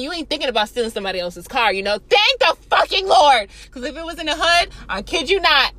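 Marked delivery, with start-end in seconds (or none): 2.1-3.5 loud, high exclamation of thanks; emphatic relief. Real, not acted. Storyteller relieved her keys weren't stolen, loud emphatic exclamation. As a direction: belt it out with relief.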